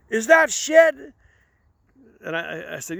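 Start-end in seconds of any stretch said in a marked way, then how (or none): 0.1-0.9 high, loud incredulous cop; mock outraged cop; disgusted cop. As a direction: bark it like a repulsed officer. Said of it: "he goes".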